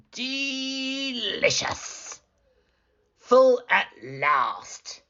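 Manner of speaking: very slow, drawn-out relish; contented full wolf; satisfied wolf. Acted. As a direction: savor the meal.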